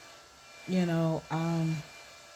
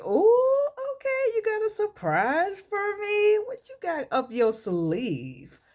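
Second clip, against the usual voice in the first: high, playful, drawn-out "ooh"; intrigued surprise; 3 dB louder, slower than usual. Retold or imitated. Wife teasing husband about his promised surprise, high and intrigued. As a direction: coo it high, then slow down teasingly.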